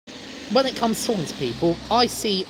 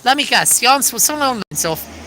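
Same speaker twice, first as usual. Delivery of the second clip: normal talking voice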